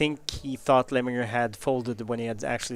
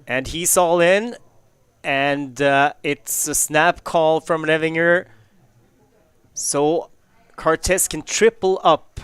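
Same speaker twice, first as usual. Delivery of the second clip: excited; 4 semitones higher, 7 dB louder than usual. Real, not acted. All-in call during live hand.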